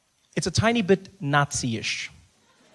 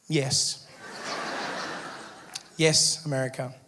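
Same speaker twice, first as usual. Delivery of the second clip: mock solemn; 4 semitones lower, 5 dB quieter, slower than usual. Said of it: "why not have some fun".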